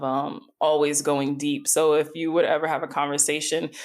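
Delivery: usual speaking voice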